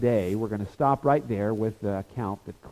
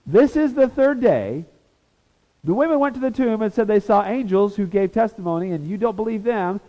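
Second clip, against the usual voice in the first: Jesus exasperated; 8 semitones higher, 4 dB louder than usual. Retold or imitated. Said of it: "He says".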